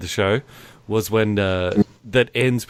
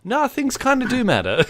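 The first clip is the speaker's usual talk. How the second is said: laughing it off, higher; 12 semitones higher than usual. Real, not acted. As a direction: say it through a laugh. Laughing rebuttal.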